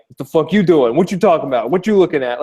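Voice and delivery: aggressive Long Island New York street voice; harsh New Yorker. Retold or imitated. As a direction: bark it like a hostile New Yorker. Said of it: "harshness and hardness".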